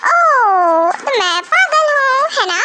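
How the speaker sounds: offended sarcasm. Acted. Wife offended by husband's excuse, slow sarcasm. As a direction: drag out the offended retort.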